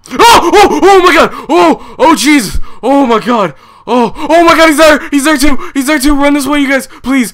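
high, loud, terrified shrieks; panicked screaming. Real, not acted. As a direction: scream in real panic as the monster appears. Horror game jump scare panic.

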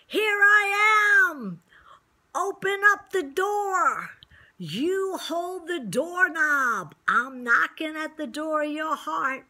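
high, loud, slow calling out; Jesus calling out. Acted. Preacher voicing Jesus at the heart's door, loud call. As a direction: call out loud to someone behind a closed door.

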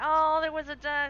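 loud sudden startled oh; startled exclamation. Real, not acted. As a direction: jolt at the noise.

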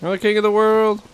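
loud, high victory yell; triumphant shout. Real, not acted. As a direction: belt it out like a champion. Triumphant shout after boss fight.

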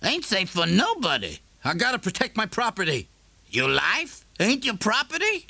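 a neighbour challenging a man in a dark shop; Morrison's voice. A narrator's reading.

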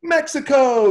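loud, high cheerful greeting; joyful shout of welcome; excited shout. Retold or imitated. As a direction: call it out with delight. Director greets actor with a hug.

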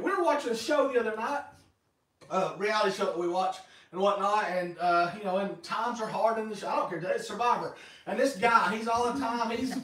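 normal talking voice

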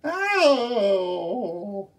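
high, loud wailing shriek; ghostly shriek. Acted. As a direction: shriek to frighten the listeners. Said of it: "his version of the ghostly shriek".

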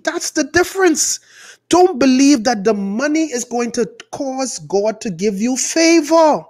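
high, emphatic correction; insistent preaching. Real, not acted. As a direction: drive the point home with urgency. Preacher drives home his correction.

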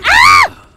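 shout. Real, not acted. Frustrated gamer yelling.

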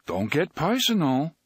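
airy, casual protest; light. A narrator's reading. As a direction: toss it off lightly. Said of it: "His voice was light".

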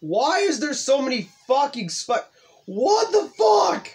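high-pitched outburst building to a shout; exasperated yelling. Real, not acted. Gamer hitting too many spikes, exasperated yelling. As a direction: rise to a loud, high yell of disbelief.

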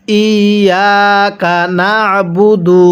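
slow, level, drawn-out ইয়াকা না'বুদু; model recitation. Shown as a demonstration. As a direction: hold ইয়াকা long, press the ayn in না'বুদু. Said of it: "কিভাবে পড়ব".

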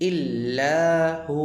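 ইল্লাহু slowly, lengthening "laa" and "hoo"; one-count stretch on both. Shown as a demonstration. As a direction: draw out both vowels at the end of ইল্লাহু. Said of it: "দুইটাতেই এক আলিফ".

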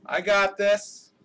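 ordinary talk, nothing marked

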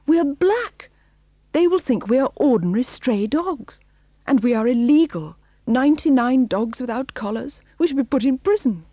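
high, loud protest; crying out. A narrator's reading. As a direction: cry it out as mounting objections. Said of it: "cried missus".

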